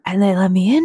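normal talking voice

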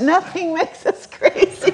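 amused grumble with a laugh in it; laughing exasperation. Real, not acted. Exasperated aside about miscast Newfoundlanders.

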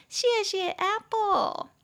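high, drawn-out singsong gratitude; mock gushing thanks. Teacher thanking Apple for the feature, mock gushing.

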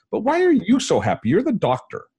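high, incredulous retold challenge; baffled question. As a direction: press the question in puzzled disbelief. Retells baffled question to giddy doctor.

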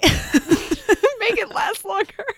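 stretched out slowly through laughter; slow, laughing drawl. Shown as a demonstration. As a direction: drag it out, laughing. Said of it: "read my one sentence very slowly".

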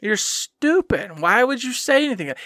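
ordinary delivery

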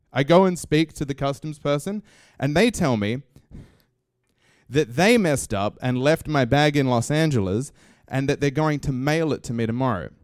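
ordinary delivery

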